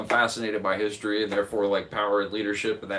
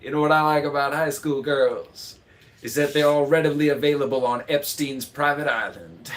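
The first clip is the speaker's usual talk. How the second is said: Matthew McConaughey impression; 4 semitones higher, 4 dB louder than usual. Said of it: "President Matthew McConaughey".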